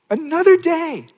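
high, loud ringing repeat; emphatic echo. Acted. Preacher urging hurting listeners, loud emphatic repeat. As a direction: hit it hard as a promise.

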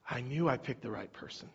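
a hint of a pleased Jesus, said softly; Jesus quietly pleased with Peter; lightly voiced Jesus. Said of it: "Jesus was like".

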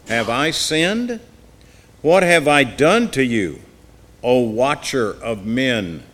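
slow anguished plea from the pulpit; impassioned reading. Acted. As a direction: cry the questions out slowly to God. Scripture read aloud, pleading.

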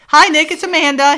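high, loud put-on hello; retold phone greeting. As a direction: imitate a woman cutting in on a call.

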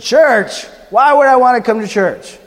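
hard case scoffing at a church invite; grumpy refusal. Acted. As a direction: sneer at the invitation.

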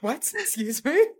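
high-pitched, incredulous questioning; shocked disbelief. Real, not acted. Speaker to friend who misheard "attractive", shocked disbelief. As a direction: react as if scandalised by what was misheard.

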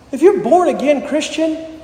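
ordinary delivery

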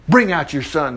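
ordinary delivery

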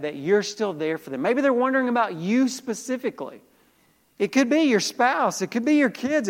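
high, loud preacher's fervor; full-force preaching. Acted. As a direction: preach it out big to the room.

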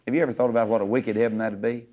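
ordinary talk

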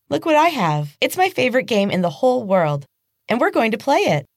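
put-on high, bright childish squeal of delight; excited little girl. Little girl showing her game to Casey, high and excited.

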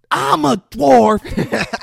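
high, slow, full-volume yell; re-enacting a screamed declaration; retold screaming. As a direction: bellow it out in acted fury. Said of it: "screaming this at her".

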